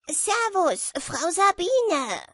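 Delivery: high, loud, drawn-out hail; shouted greeting. A narrator's reading. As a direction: shout hello across the water. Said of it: "schrie Harald". (lauter, hoher Begrüßungsruf)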